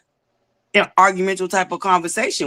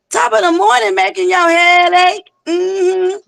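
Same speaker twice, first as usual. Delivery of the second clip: playful high greeting; 12 semitones higher, 3 dB louder than usual. Real, not acted.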